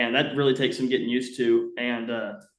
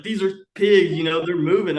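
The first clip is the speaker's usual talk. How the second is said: higher, louder swearing it's so; imitated adamant insistence; 6 semitones higher, 5 dB louder than usual. Dad swearing to the hunter they're pigs, imitated insistence. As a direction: mimic someone stubbornly sure, pushed up in pitch. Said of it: "He swore up and down".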